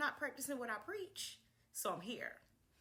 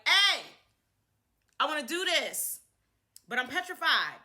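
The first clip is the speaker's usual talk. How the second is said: loud plea to the ancestors, half-joking; 4 semitones higher, 11 dB louder than usual. Retold or imitated. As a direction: call out to them loud and anxious. Pleading with the ancestors.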